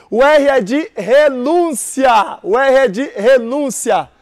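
"renúncia" stretched slowly, every word weighed; slow drilled repeat. Shown as a demonstration. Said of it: "slow drilled repeat". (professor repete bem devagar)

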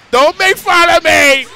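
mock outraged yell. Real, not acted. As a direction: yell it out, hurt but playful. Yelling back at the game.

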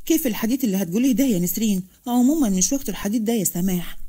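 ordinary delivery, nothing marked

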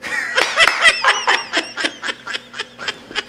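high breathless laugh. Real, not acted. Teller cracking up at the punchline, high laugh.

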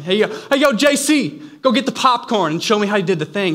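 loud, high and fast hey yo; giddy fan yelling for the replay; excited call. Pretend. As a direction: shout it up like greeting a buddy. Imagines greeting Jesus in heaven.

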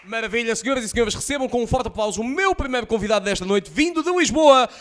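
building to a huge shout; grand entrance announcement. Acted. Host introduces the comedian.

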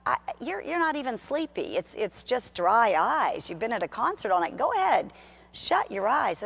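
sweet, lulling temptation voice; lying inner voice. Retold or imitated. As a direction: coax like a sly voice in your head. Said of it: "And that voice said".

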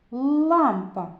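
"Лампа" with a long, hard Л; first sound stretched. Shown as a demonstration. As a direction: lean loudly on the Л that opens "Лампа". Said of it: "Зробимо звуковий аналіз слова".

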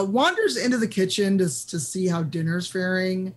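usual speaking voice

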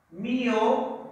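"mio" held as one separate syllable. Shown as a demonstration. Teacher dividing miopía for pupils, isolating "mio" as a syllable. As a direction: sound out "mio" alone, then stop. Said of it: "Son tres sílabas".

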